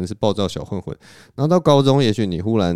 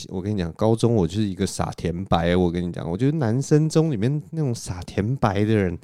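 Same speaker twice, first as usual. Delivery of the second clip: ordinary talk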